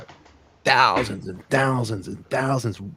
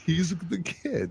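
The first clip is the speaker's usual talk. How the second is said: laughing; 6 semitones higher, much slower than usual. Real, not acted. Laughing hype.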